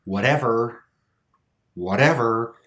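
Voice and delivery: "whatever" with the T tapped like a D; flap T. Shown as a demonstration. Teacher showing an exception, "whatever" with flapped T. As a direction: stress the middle of "whatever", still flapping the T. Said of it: "we still make that flap sound".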